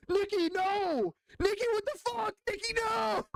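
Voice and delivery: very high, hammed-up shrieking handler; mock screaming handler. Handlers screaming at Nicki through earpiece, shrieking high. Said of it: "her handlers are screaming at her".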